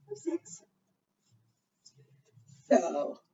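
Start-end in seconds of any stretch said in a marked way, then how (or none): 0.1-0.6 high, excited "We have six."; high-pitched thrilled reveal; bright exclaim. Acted. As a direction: pop up into a delighted high note. Repeat after whisper.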